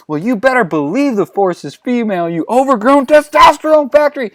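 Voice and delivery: high rant building to a yell; furious insult. Acted. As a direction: build from high indignation to a loud shriek. Acted angry insult.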